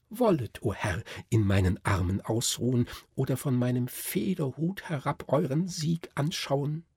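a lady doll pleading gently with the hero; Klärchen urging the Nutcracker to rest, lightly voiced lady; a hint of a genteel lady. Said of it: "sprach jetzt".

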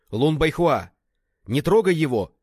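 high, loud warning cry; alarmed shout. A narrator's reading. Beaten man calling off his armed rescuer, alarmed shout. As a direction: shout to stop an attack. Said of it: "крикнул Ван Сяоши".

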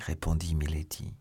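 ordinary delivery, nothing marked

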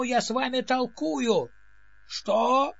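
usual speaking voice

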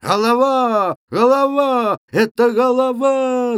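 high, loud, long-held cries; alarmed shouting. A narrator's reading. Lads recognizing the village head and scattering, shouting. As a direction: yell the warning, stretching the last syllable. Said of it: "закричали хлопцы".

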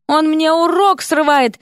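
high, indignant squeal; high-pitched, drawn-out protest; squealed complaint. A narrator's reading. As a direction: shriek it like an outraged teacher. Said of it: "взвизгнул преподаватель истории".

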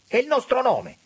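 very high, loud cry. A narrator's reading. Auriti pleads for the family name, crying out. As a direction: cry out at full pitch. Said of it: "A questo grido".

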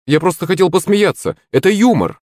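high-pitched innocent justification; defensive excuse. A narrator's reading. Акулыч explaining he only wanted a laugh, defensively. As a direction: excuse yourself in a high, light voice.

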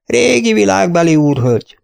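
normal talking voice

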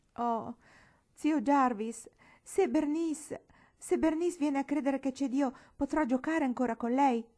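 high, loud wailing plea; tearful pleading. A narrator's reading. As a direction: beg your uncle through tears, faltering at first. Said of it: "questa cominciò a piangere". (supplica piagnucolosa)

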